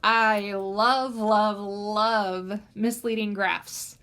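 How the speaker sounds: slow drawn-out love, love; gushing nerdy delight. Real, not acted. Teacher gushing to students about loving misleading graphs. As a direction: savour each love like a confession of a favourite thing. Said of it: "I know I'm a nerd".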